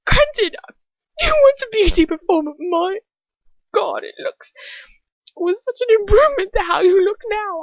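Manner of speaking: high, loud, gleeful taunt; breathless mocking laughter. A narrator's reading. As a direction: jeer loudly, fighting for breath. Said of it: "struggling to get her breath through her laughter".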